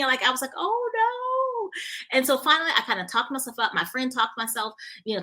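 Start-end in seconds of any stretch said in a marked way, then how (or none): 0.6-1.7 high, drawn-out "oh no"; mock-dread squeal, held long; dismayed oh no. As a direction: wail a long, high-pitched oh no. Retells her dread at filming.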